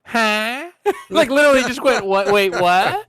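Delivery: Cory Barlog's mock startled reaction; mock surprise. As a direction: play the caught-off-guard what, hammed-up. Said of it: "spotted him and was like".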